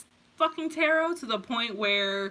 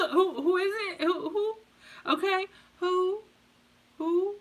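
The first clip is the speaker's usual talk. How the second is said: hooting who like an owl, higher, with laughter; 8 semitones higher than usual. Pretend. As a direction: play the owl, giggling through each who. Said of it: "you sound like a goddamn owl".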